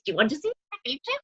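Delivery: quick, hushed witch voice; spooky witch asking a question; witchy voice. Retold or imitated. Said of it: "with that witchy voice".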